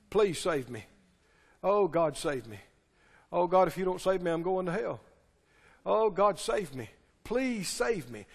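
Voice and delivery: soft, low whimpering plea; mock begging for salvation. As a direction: imitate a sinner begging at the altar.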